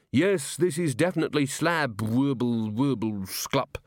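slow speech dissolving into babble; drugged, slowing garble; addled constable. A narrator's reading. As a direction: start sure, then slide into woozy nonsense. Constable addled by tasting a drug.

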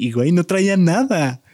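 growing disbelief while telling; amazed. Real, not acted. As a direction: build the amazement.

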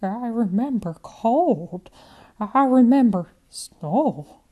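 put-on slow, with a trace of an Eastern European accent; slight Eastern European accent, remembering slowly; faint foreign accent. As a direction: let a hint of a Russian accent creep in. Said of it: "The trace of an accent creeps in".

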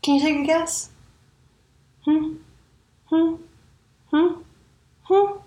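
rising teasing hums that climb each time. Real, not acted. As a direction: goad the audience into guessing. Teasing listeners to guess.

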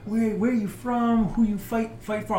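high, gushing curious fan; eager crowd voice. Retold or imitated. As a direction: pepper them with questions. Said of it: "and you being like".

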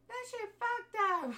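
high, loud burst of disbelief; excited outburst. Real, not acted. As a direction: blurt it out in shock. Reacting to the film's ending.

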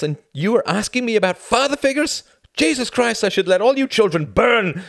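loud, high, climbing outrage; furious rant. Acted. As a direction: rage at the world's blindness.

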